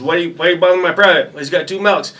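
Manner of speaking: high, loud mock interrogation; drill sergeant voice. Other battery's drill sergeant questioning a recruit, high mock bark. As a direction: grill him like a drill sergeant.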